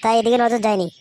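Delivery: ordinary talk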